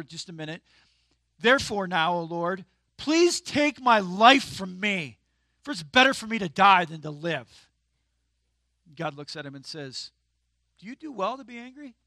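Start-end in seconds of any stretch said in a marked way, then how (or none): 1.4-7.7 loud, drawn-out plea, slow then pressing; hammed-up "just take my life"; dramatic despair. Jonah's angry prayer voiced. 10.8-11.9 wry question. Retold or imitated. God's wry question voiced.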